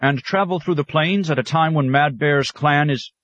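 a proud scholar bristling, breaking off; irritable huff. A narrator's reading. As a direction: huff it out, annoyed at the idea. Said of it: "huffed irritably".

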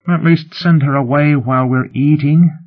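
high-pitched plea; pleading. A narrator's reading. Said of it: "Ryle pleaded".

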